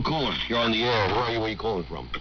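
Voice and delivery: ordinary talk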